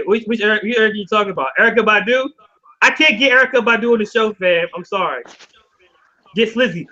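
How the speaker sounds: ordinary talk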